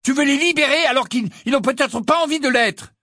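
high, loud angry protest; angry argument. Acted. Ron protests against tricking the elves, angry. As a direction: keep the anger pushing hard.

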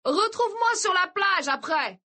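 high, sustained distant call. A narrator's reading. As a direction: shout across a crowd, without full volume. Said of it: "cria Mark à Kirsty".

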